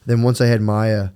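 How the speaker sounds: usual speaking voice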